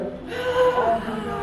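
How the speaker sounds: high, breathy pretend gasp with a laugh; fake gasp. Shown as a demonstration. Idol faking surprise for fans, gasp. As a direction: gasp as if shocked, hammed up. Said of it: "Pretend to go".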